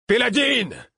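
high, drawn-out cry falling at the end; roared name. A narrator's reading. Laudevin roars as the animal attacks, high long cry. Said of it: "rugit Laudevin".

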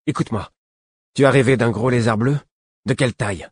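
put-on teen prince abruptly urgent about the dream; urgent tension. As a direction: press him suddenly, tense and eager. Prince suddenly tense and urgent.

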